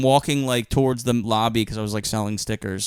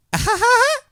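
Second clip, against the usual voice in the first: imitated child laugh; 3 dB louder than usual. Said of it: "A very clear child laughed".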